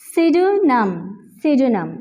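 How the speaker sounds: pseudonym with the p dropped, "sudonim"; silent p in pseudonym. Shown as a demonstration. Teacher drilling pseudonym without p. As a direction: begin pseudonym straight on s. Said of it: "यहाँ भी पी हमारे पास silent है".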